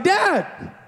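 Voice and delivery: high whiny call of Dad!; a child's lightly voiced Dad! to his father; child's whine. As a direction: cry Dad! like a frustrated four-year-old. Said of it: "he's like".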